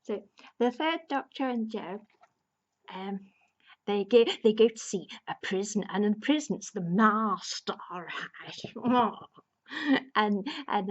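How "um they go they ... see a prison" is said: normal talking voice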